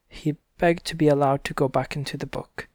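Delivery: plain reading voice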